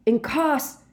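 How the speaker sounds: high, forceful cry; roared spell. A narrator's reading. Harry attacking Snape, roaring. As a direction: roar the curse out, pitched high. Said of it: "Harry roared".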